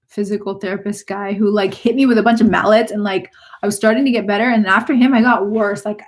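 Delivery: ordinary delivery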